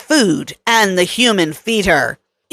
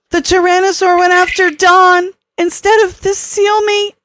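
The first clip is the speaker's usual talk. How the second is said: Kelly recounting; 11 semitones higher than usual. A narrator's reading. Kelly telling Troy how Don was attacked, higher voice. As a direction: tell it as shaken Kelly in a raised register.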